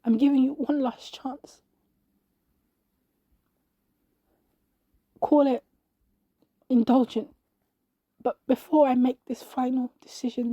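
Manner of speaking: pleading, holding back tears; desperate plea. A narrator's reading. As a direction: bargain with heaven as a last resort. Desperate last plea to God.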